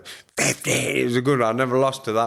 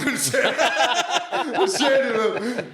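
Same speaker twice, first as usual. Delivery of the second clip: laughing; 14 semitones higher, much slower than usual. Real, not acted. Laughing while retelling the changing room.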